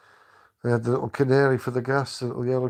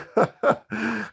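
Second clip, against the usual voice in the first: hearty laugh; 10 semitones higher than usual. Real, not acted. Laughing at the memory.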